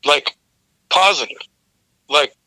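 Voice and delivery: ordinary talk, nothing marked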